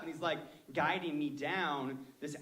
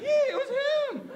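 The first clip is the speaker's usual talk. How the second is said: squeaky, much higher and louder, slow; scared high voice; 11 dB louder, slower than usual. Shown as a demonstration. A scared driver blaming the guide, squeaking very high. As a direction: pitch leaps up in fear. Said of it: "your voice goes high when you're really scared".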